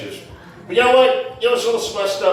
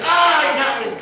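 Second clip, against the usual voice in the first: high-pitched comic cry of defeat; mock kryptonite agony; 7 semitones higher than usual. Exposed driver caught out by comic, mock agony. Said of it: "like I hit him with kryptonite".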